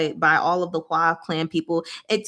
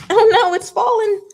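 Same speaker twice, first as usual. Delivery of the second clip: high, loud sudden oh no; startled; 13 semitones higher, 6 dB louder than usual. Real, not acted. Reviewer startled when her note falls, surprised. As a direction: yelp as something drops.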